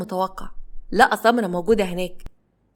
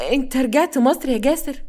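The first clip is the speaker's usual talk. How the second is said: higher, frightened questioning; understated tearful alarm; tearful fear; 5 semitones higher than usual. A narrator's reading. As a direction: ask it fearfully, voice pitched up. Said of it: "ودموعها كانت نازلة على وشها بخوف".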